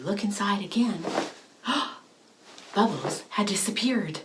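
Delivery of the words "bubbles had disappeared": ordinary delivery, nothing marked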